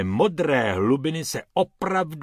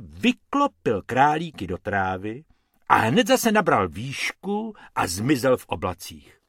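ordinary delivery, nothing marked

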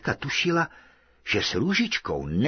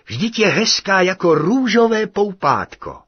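high, loud cry of wonder; admiring exclamation; 8 semitones higher, 6 dB louder than usual. A narrator's reading. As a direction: exclaim it loudly in high-pitched delight.

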